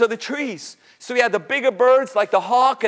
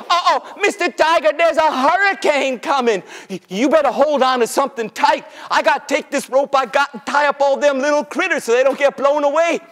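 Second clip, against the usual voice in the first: hurried, urgent warning; feigned panic; 3 semitones higher than usual. Pretend. Rabbit rushing to tie up critters, urgent panic. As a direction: rattle it out in mock alarm.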